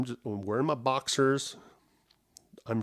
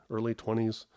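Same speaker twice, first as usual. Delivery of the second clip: normal talking voice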